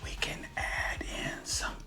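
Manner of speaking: soft unvoiced whisper. Real, not acted. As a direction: whisper it for dramatic effect. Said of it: "Why are you whispering?".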